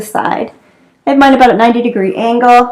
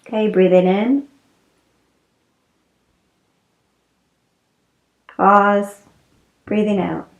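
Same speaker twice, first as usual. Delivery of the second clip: guided relaxation; 3 semitones lower than usual. Real, not acted. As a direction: ease them into the breath, unhurried and settling. Leads restorative breathing relaxation.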